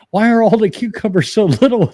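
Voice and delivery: high, loud innocent question; imitating wife asking. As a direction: ask it in the wife's high innocent voice. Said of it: "I'm like".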